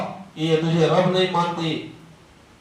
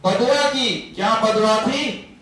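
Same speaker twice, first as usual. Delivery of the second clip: ordinary talk, nothing marked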